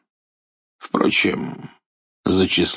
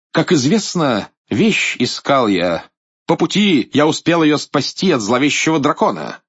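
loud, high boastful knight; proud hero tells of his deed; heroic knight; 7 semitones higher, 4 dB louder than usual. Acted. Белый рыцарь хвастливо рассказывает. (хвастливый рыцарь)

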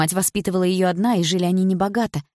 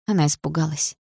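ordinary delivery

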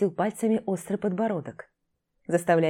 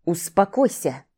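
slow growled command; growling; slower than usual. Acted. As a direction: growl it back at her. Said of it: "зарычал в ответ Данилов".

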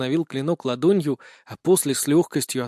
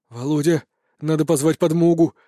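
plain reading voice

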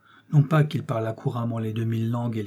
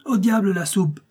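angry outburst; 6 semitones higher than usual. A narrator's reading. Professor snapping at the maid about the soup, angry cry. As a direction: cry out, pitch leaping high. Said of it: "s'écria mon oncle".

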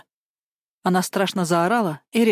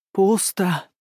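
gasping. Acted. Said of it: "ахнула она".

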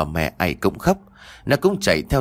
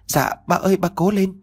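put-on high-pitched little child voice; anxious child; 11 semitones higher than usual. A narrator's reading. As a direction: plead in a much higher child's voice. Child urges injured father on.

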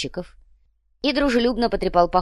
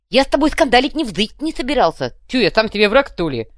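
high, loud lisping protest; protesting fuhrer; 6 semitones higher, 7 dB louder than usual. A narrator's reading. Fuhrer denies wanting a scandal, protesting. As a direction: protest in a lisped character voice.

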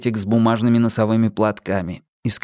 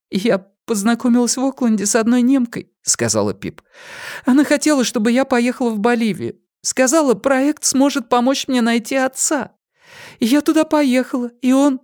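put-on higher, hesitant young woman; 14 semitones higher than usual. A narrator's reading. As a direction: confess timidly as a young woman. Pip voiced by narrator.

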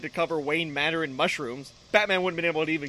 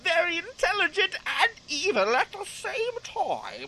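Bane; 11 semitones higher than usual. Acted. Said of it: "I actually quite liked the Bane voice".

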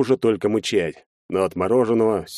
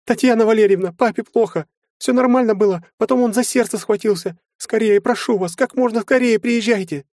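very high, panicked plea; frightened daughter babbling into the phone; distraught high voice, near breaking; 14 semitones higher, 4 dB louder than usual. A narrator's reading. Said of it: "срывающимся голосом залепетала".

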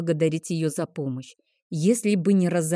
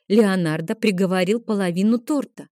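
ordinary delivery

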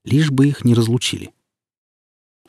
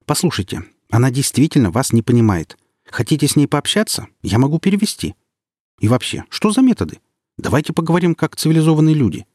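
angry; 6 semitones higher than usual. A narrator's reading. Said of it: "Сердито сказала".

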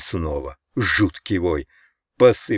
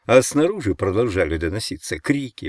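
usual speaking voice

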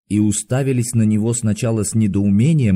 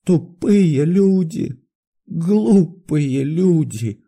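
high but less forceful, very drawn-out lament; feverish repetition; 7 semitones higher, much slower than usual. A narrator's reading. Doctor trembling alone on the sofa, repeating bitterly. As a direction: repeat it slowly, spent after the outburst. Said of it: "дрожа как в лихорадке ... долго ещё повторял".

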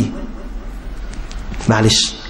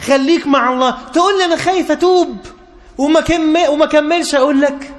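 preaching exchange climbing steadily higher in pitch; each answer pushes higher, rising to a peak; building sermon; 9 semitones higher than usual. Acted. Preacher answering each fear of the youth, voice climbing ever higher.

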